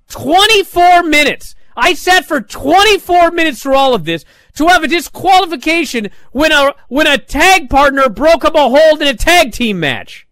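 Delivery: high, loud exasperated rant; fuming rant. Real, not acted. Reviewer fuming about a twenty-four minute segment, exasperated. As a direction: vent the wasted time in disbelief. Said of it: "Now I was fuming".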